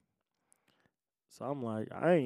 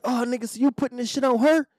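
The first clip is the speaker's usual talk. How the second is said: mock accusation; 14 semitones higher, 7 dB louder than usual. Officer accusing man of blaming sister, mock accusation. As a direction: imitate the officer, very high and loud.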